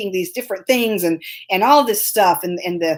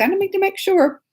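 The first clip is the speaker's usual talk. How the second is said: much higher, insistent pitch; insistent mother; 10 semitones higher than usual. Retold or imitated. Mom telling cruise staff about the birthday, high and insistent. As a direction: push it up high, not taking no for an answer. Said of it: "I'm like".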